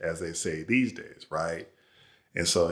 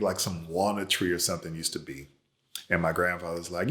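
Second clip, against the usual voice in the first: usual speaking voice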